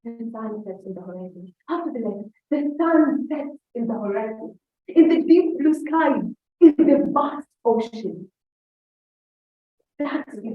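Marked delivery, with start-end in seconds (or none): 0.0-1.5 soft, low, unexpressive recital; underplayed poem line; recited without expression. Shown as a demonstration. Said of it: "I just can start and say". 2.5-8.3 slow, swelling, grand recitation; expressive poem recital. Shown as a demonstration. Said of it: "I want to show how".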